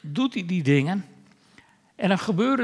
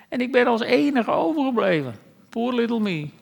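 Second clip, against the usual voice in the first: higher, whiny pity-me voice, lightly mocking; a touch of hammed-up self-pity; playfully mocking a sulking prophet; 5 semitones higher than usual. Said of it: "Vol van zelfmedelijden".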